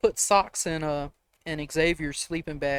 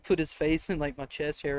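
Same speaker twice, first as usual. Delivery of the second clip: usual speaking voice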